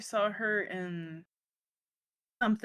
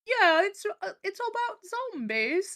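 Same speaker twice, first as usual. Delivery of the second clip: mocking a clumsy British accent, pitched way up; bad British accent; 12 semitones higher, slower than usual. Mocking a zombie show actor's bad British accent. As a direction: ham up a terrible British accent. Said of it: "that was the worst British accent ever".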